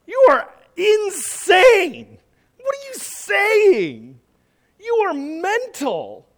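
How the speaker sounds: high, slow, sneering caricature voice; mocking governor. Festus ridiculing Paul, high slow sneer.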